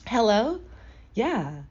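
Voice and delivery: high, feminine phone hello; put-on feminine voice. Shown as a demonstration. Younger self on the phone to men, putting on a feminine voice. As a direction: pitch it up and play feminine. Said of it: "I would try to sound so feminine".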